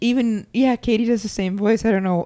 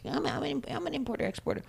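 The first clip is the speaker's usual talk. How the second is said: higher, softer mom impression; 8 semitones higher, 9 dB quieter than usual. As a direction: do your mom, pitched up and soft. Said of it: "when I do the impression of you".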